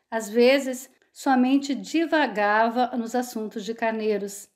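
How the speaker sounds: ordinary delivery, nothing marked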